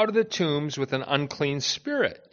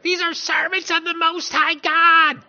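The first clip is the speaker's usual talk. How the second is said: high, loud, shrieking mock demon; shrill demonic voice; 13 semitones higher, 6 dB louder than usual. Preacher mimicking the slave girl's shrill demonic cry. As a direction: screech it like the possessed slave girl. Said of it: "But if you can hear it, it's like".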